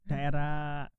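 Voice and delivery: ordinary delivery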